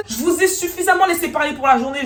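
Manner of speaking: loud, high-pitched cut-off; re-enacted irritation; acted angry retort. Answering back in the quarrel.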